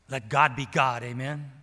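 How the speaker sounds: loud, driving pulpit voice; full-force preaching. Acted. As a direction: preach it out to the whole church.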